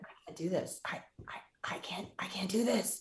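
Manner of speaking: hushed, breathless whisper of panic; panicked whisper. Retold or imitated. Overwhelmed new mother in hospital, panicked whisper. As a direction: whisper as if drowning in anxiety.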